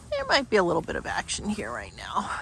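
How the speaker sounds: usual speaking voice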